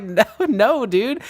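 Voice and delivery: high-pitched protesting denial; amused denial. Retold or imitated. Accused pledge answering the British guy, high protest. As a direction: deny it in a high, incredulous voice. Acting out his laughing denial.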